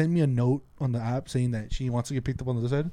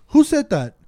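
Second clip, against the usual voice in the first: pitched-up, louder challenge to the rider; sharp demand; 11 semitones higher, 7 dB louder, slower than usual. Retold or imitated. Driver confronting a rider, sharp raised challenge. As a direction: snap the question at her in the mirror.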